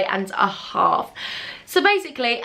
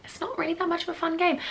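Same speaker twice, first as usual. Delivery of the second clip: mock self; 7 semitones higher, 7 dB quieter than usual. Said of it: "I was like".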